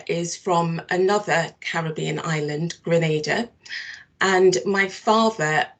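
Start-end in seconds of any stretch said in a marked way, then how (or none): none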